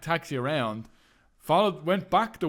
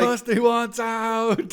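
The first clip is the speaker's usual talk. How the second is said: very slow, higher drawn plea; mock plea for rescue; 8 semitones higher, 4 dB louder, much slower than usual. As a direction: drag it out pleading. Mock whiny quote.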